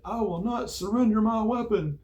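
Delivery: high, loud recruit's refusal; sustained high mock shout of defiance; defiant shout. Acted tussle, recruit defying yelling chief.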